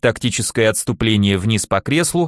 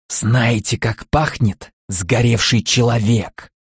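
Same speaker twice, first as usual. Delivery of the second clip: slow eerie whisper; hushed creeping horror; slower than usual. Acted. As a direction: spook the little girls, slowly. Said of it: "жутким голосом прошептал я". (жуткий шёпот)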